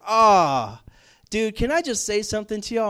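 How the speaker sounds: fired-up college guy interrupting the car ride; excited outburst. Retold or imitated. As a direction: burst in, needing to vent. Friend starts rant in car.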